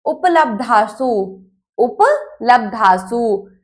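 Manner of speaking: उपलब्धासु said slowly and syllable by syllable, u-pa-labdhā-su; teacher modelling उपलब्धासु slowly for the class; slow pronunciation drill. Shown as a demonstration. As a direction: spell out उपलब्धासु carefully for students to repeat. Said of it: "मेरे साथ बोलेंगे".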